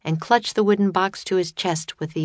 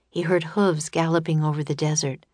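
ordinary delivery, nothing marked

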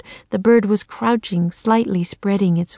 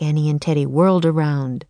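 usual speaking voice

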